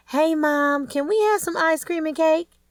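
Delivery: put-on high, eager little girls asking; kids' voices. A narrator's reading. Little girls begging their new stepmom for cake, eager and high. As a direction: pitch up into excited little girls. Kids running up to new wife.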